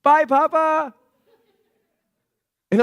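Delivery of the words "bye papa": put-on high, bright little-girl voice, lightly cheerful; a child's carefree bye; little girl's goodbye. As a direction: chirp it like a toddler waving off her dad. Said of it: "she turns around and goes".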